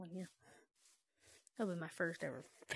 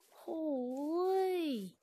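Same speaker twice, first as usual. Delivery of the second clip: usual speaking voice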